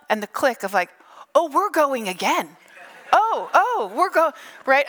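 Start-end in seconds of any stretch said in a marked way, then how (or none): 1.4-4.3 high, startled rider's yelps; hammed-up rising panic; mock alarm. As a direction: play caught off guard as the ride lurches off. Acted alarm as ride restarts.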